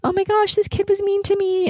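put-on high whiny child wailing to her parents; crying kid. Podcaster voicing her teenage self complaining to parents, high tearful whine. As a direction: play your younger self coming home upset. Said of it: "when I came home crying".

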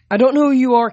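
gruff shout. A narrator's reading. Coach shouting over storm.

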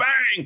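high mock "Bang!" impact yell; mock impact shout. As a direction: bark out a hit sound. Sound effect of wrestling move.

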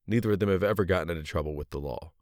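plain reading voice

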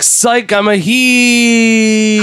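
high, slow gloating taunt; gloating heel. Pretend. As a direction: sneer it like a wrestling villain. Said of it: "he deleted it and was like".

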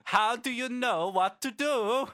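very high, loud, slow read-out; mock question-reading voice. Host reading a listener's question, hammed-up. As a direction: belt it out word by word, high-pitched.

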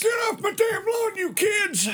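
put-on high, far-off cranky old man shout; grumpy old man yelling. Podcast host mocks the band as an old man yelling at kids, cranky shout. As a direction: holler like a crotchety geezer at kids. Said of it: "old grumpy man yelling at a cloud".

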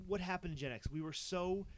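ordinary talk

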